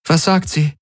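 high, anxious little question; trembling question. A narrator's reading. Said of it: "fragte Kirai zitternd".

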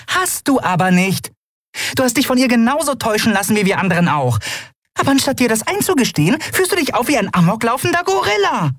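fast, very high tirade; berating Nelen. Acted. Nelen berating Keru for denial, fast high tirade. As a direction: rattle off the scolding, pitched very high.

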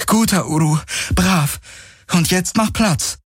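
high, encouraging animal talk; relieved praise. A narrator's reading. Kirai praises her mount for coming, encouraging.

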